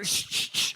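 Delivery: sharp repeated "shh"; quick, voiceless shushes; mock shushing. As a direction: hush the beggar dismissively. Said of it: "trying to kick dirt on".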